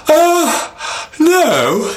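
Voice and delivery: very high yawning no. Acted. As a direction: yawn the word out lazily. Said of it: "yawned Nwame".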